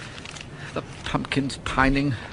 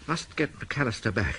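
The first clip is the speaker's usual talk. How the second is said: quieter, lower repeat to himself; muttered to himself; 5 semitones lower, 8 dB quieter than usual. Acted. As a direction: sink into a low murmur. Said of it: "I must get McAllister back".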